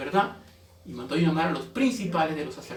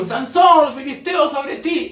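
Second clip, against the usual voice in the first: high, loud alarm cry; dramatic high warning call; 8 semitones higher, 6 dB louder, slower than usual. Acted. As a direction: shout to rouse a sleeper. Acted warning cry waking Samson.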